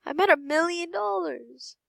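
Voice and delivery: high, thrilled exclamation; excited disbelief. Real, not acted. Player realizing the first million, high excited exclamation. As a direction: pitch it well up in excitement.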